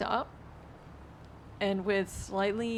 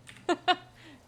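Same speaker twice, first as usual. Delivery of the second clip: loud laugh; 11 semitones higher, 7 dB louder than usual. Real, not acted. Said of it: "The fuck is".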